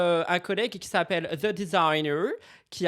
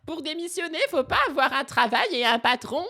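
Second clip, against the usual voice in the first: quoting a viewer; 9 semitones higher, 4 dB louder than usual. Retold or imitated. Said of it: "quelqu'un qui a dit concernant Mila".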